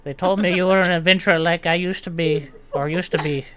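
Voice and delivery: high, loud, increasingly confused questions; bewildered old gnome. Acted. Amnesiac gnome questioning his new dad, high and loud. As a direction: spiral into confusion.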